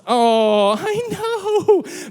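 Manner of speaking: high drawn-out "aww" coo; very high, cutesy sympathy; doting coo. Acted. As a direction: gush over an adorable puppy. Cooing over the puppy's picture.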